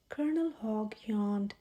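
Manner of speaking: usual speaking voice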